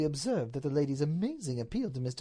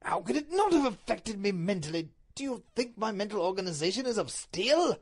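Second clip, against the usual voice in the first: lightly voiced indignant Duke, pitched up; put-upon husband, mildly worked up; a touch of wounded indignation; agitated Duke; 6 semitones higher, 3 dB louder than usual.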